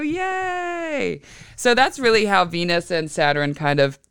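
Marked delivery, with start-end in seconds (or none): 0.0-1.2 little cheer up high and soft; a quiet happy cheer; soft high yay. Real, not acted. Mother cheering her kids' swim test, soft and high. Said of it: "celebrating kids passing".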